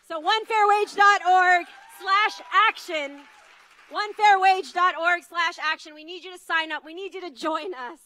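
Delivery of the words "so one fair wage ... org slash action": loud, raised call to the crowd; rallying call. Real, not acted. Organizer urging the crowd to sign up, loud rallying call. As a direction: shout the web address to the crowd.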